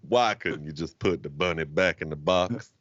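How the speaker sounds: low, slow mock Southern drawl; hammed-up Con Air Southern accent, low and slow; Nicolas Cage Southern accent. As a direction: imitate a bad movie Southern accent, low and drawn out. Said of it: "Nicolas Cage does not do a good Southern accent".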